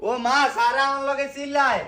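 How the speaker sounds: high-pitched tattling call; calling out complaint. Acted. Child telling mother the collector shouts, calling out.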